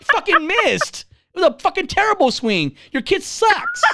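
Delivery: high-pitched outraged scolding; yelling. Retold or imitated. Tough-love parent on a missed swing, yelling. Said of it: "Like, yelling at him".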